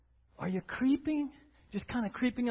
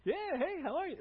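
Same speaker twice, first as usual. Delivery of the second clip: cheery greeting; 8 semitones higher, 4 dB quieter than usual. Pretend. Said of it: "Bill's probably like".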